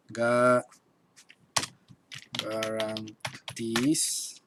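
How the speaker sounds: "garantiz" very slowly, in drawn-out pieces with pauses; syllable by syllable. Shown as a demonstration. Teacher writing out the word for students, slow syllable by syllable. Writes the word out while saying it.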